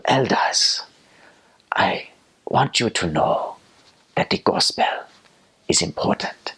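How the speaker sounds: put-on slow, halting African-accented preacher, long pauses; solemn impersonation with big pauses and breath; President Dube. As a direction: deliver it slowly and weightily, phrase by phrase. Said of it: "our best President Dube impersonations".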